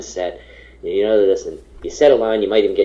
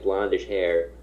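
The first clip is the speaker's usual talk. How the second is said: usual speaking voice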